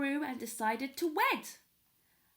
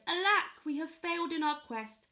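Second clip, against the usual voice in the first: higher, louder mock lament; 5 semitones higher, 5 dB louder than usual. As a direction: cry out in dramatic despair. Grandfather laments failure.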